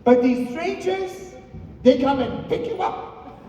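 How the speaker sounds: very high-pitched imitation of a worried mother; exaggerated high mother voice; suspicious mom. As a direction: build the case like a baffled mom. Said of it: "She said".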